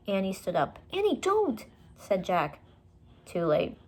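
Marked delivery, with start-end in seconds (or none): 0.9-1.6 high, alarmed plea; high-pitched alarmed call. A narrator's reading. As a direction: stop her in alarm. Trying to stop sister.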